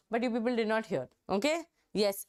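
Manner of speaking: usual speaking voice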